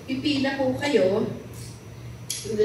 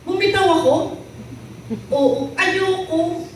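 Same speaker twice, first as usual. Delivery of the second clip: scared refusal; 8 semitones higher than usual. Acted. Man clinging to cliff refuses God, high and scared. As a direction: recoil at the idea, voice up high.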